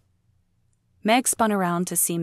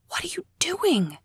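sharp higher whispered hiss; 12 semitones higher than usual. Acted. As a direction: hiss it at him in alarm. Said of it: "she hissed".

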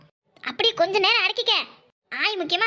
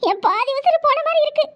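hammed-up grief; 7 semitones higher, 3 dB louder than usual. Fake grief to seduce uncle.

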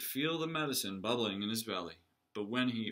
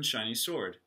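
ordinary delivery

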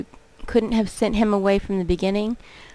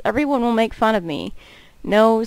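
ordinary talk, nothing marked

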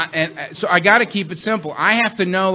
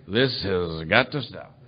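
slow grim vow; 10 semitones lower, 7 dB quieter, slower than usual. Retold or imitated. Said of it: "I would say".

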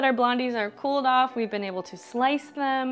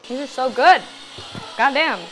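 talking through a crunchy mouthful; chewing while talking; mouth full; 6 dB louder than usual. Real, not acted. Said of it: "It sounded like Popeye just now".

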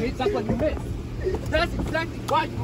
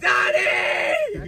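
crying shout; 7 semitones higher than usual. Acted. Calling for help mid-fight.

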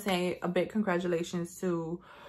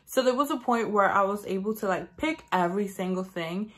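ordinary talk, nothing marked

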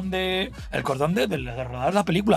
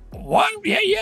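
much higher, starstruck yeah yeah in broken English; mock English fawning; 14 semitones higher, slower than usual. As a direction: gush like a fan with poor English. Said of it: "yo con mi inglés". (imitando su inglés torpe, mucho más agudo y embelesado)

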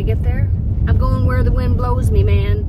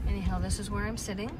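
ordinary talk

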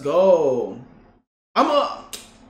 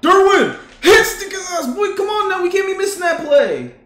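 loud, high hype at the screen; real excitement, shouting at the TV; excited yelling; 12 semitones higher, 10 dB louder than usual. Real, not acted. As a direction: yell the team on. Hyped gamer yelling at the game.

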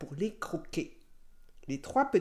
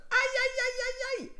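very high string of ouches; high-pitched hurt wolf wailing; howl of pain. A narrator's reading. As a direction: yelp rapidly in pain. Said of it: "en hurlant de douleur".

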